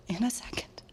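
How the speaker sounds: whispered, breaking with grief; barely audible, choked whisper of grief; voice fading to a whisper. Real, not acted. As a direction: let the words die away into a whisper. Breaks down recounting brother's death.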